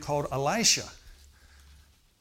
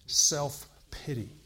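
drawn out; much slower than usual. Real, not acted. Preacher dwelling on key word.

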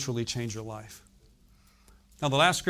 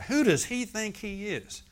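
mocking religious folks; 7 semitones higher, slower than usual. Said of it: "the religious folks will get mad".

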